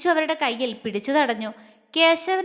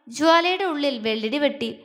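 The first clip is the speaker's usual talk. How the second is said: ordinary delivery, nothing marked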